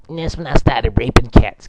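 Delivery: usual speaking voice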